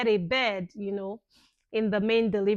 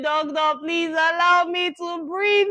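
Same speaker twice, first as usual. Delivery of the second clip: high, loud, urgent plea; begging; 7 semitones higher, 8 dB louder than usual. Retold or imitated. Mother retelling her plea to the doctor, urgent begging. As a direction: plead up high and push it out louder. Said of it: "I was begging the doctor".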